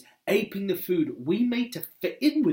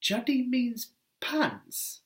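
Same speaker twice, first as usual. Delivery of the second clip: slow, higher, drawn-out reveal; deadpan reveal; 9 semitones higher, much slower than usual. Shown as a demonstration. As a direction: land the punchline slowly. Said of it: "as we all know".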